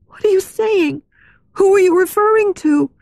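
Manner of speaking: very high, startled heroine voice; alarmed demand. A narrator's reading. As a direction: question him in high-pitched alarm. Christine alarmed by his accusation.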